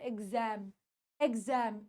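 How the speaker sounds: quiet, low एग्जाम as eg-zem; soft careful एग्जाम with a z. Shown as a demonstration. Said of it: "एक्स एम ऐसे बोलना है।".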